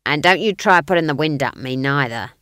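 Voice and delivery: loud guard voice. A narrator's reading. Guard warning Granny off, loud role voice. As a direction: warn her off as the guard, loudly. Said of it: "the guard began".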